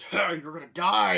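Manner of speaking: high, loud taunting yell; hammed-up villainous holler; imitated threat. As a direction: bellow a threat mid-fight. Donkey Kong threatens Mario.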